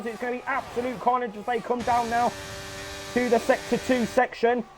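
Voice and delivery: lively live play-by-play; excited sim racing commentator; race commentary. Acted. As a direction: call the race as it happens.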